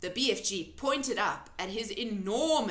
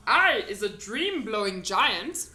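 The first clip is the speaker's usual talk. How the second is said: loud, folksy friendly giant; 8 dB louder than usual. A narrator's reading. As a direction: play the big kind giant. BFG voice.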